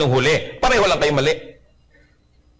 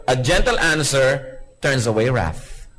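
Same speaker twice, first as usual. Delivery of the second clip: slow, lowered scripture quote; scripture reading; 3 semitones lower, slower than usual. Acted. Pastor quotes Proverbs to the congregation, slow reading. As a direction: read it slowly like a verse.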